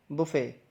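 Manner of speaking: बुफे with silent t, "bu-fay"; correct pronunciation. Shown as a demonstration. Teacher repeats the right form, correct pronunciation. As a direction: end बुफे on an open "ay", falling. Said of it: "इसकी सही pronunciation है".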